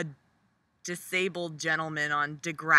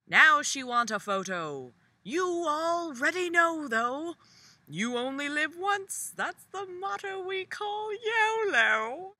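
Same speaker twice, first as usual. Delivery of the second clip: high mocking sing-song; 11 semitones higher than usual. Quoting the song lyric.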